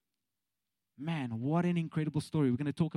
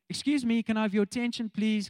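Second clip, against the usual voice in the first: raised, higher-pitched public announcement; calling for attention; 7 semitones higher, 4 dB louder than usual. Acted. Preacher puts the psalmist in modern words, calling for attention. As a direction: politely call a room to order.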